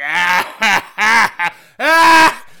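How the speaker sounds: very loud, high imitated laugh; hammed-up creepy guffaw, burst by burst; mocking cackle. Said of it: "where she's laughing at you".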